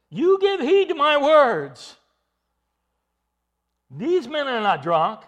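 loud, high call to the crowd; shouting over the crowd; Peter shouting. Acted. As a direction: demand they listen. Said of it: "Peter had to shout above them".

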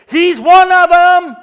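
high, very loud, drawn-out accusation; accusing shout. Acted. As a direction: cry it out to the bystanders, slow and high. Servant girl accusing Peter to bystanders.

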